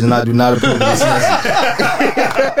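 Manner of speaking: slow, high, laughing announcer voice; parodying an ad disclaimer, laughing; mock ad disclaimer. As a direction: ham up the political-ad sign-off. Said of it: "This is [name]".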